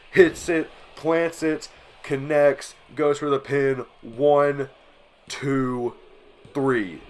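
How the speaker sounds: big-moment ringside commentary; excited play-by-play. Acted. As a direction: call the finishing move and count the pin with rising excitement. Calls the finishing move live.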